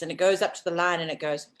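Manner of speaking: ordinary delivery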